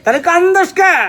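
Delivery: very high, full-volume shout; exasperated yell. Acted. Husband fed up with wife's needless crying, yelling. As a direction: explode with a stretched, baffled question.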